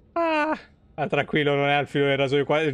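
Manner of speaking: ordinary delivery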